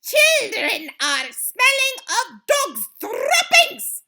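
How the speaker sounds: slow, high, loud screech. A narrator's reading. Grand High Witch working up her audience, screeched. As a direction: screech it out word by word. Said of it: "screeched the Grand High Witch".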